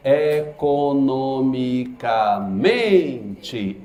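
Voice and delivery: "Economicamente" drawn out very slowly, syllables spaced. Shown as a demonstration. Teacher slowing down to show the stressed syllable. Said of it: "a que tem a mesma sílaba tônica".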